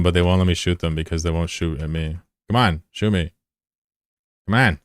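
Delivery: ordinary talk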